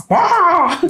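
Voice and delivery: high, loud cockatrice screech; creature screech. Acted. Game master voicing the attacking cockatrice, high loud screech. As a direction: let out a piercing animal cry. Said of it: "I think that's the voice".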